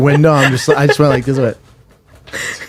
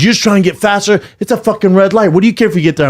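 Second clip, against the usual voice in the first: angry shout; 7 semitones higher, faster than usual. Retold or imitated. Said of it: "He goes".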